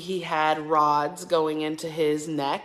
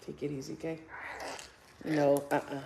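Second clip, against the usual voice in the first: soothing; 10 dB quieter than usual. Real, not acted. Mother soothing upset baby.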